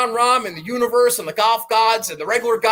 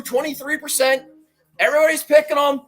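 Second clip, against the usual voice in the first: ordinary delivery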